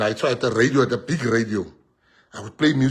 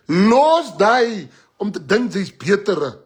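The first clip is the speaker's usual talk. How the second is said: slow emphatic scolding; 6 semitones higher, 6 dB louder, slower than usual. Real, not acted. Preaching at audience.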